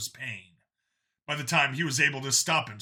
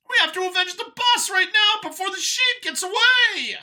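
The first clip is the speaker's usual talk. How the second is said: much higher, loud, squealing rage; furious creature shout; 9 dB louder than usual. Acted. Howler defying the Satyrs' orders, high furious shouting. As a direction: scream defiance like a cornered beast.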